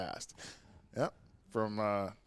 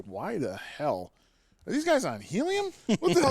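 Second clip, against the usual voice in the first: baffled; 9 semitones higher than usual. Retold or imitated. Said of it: "I'm like".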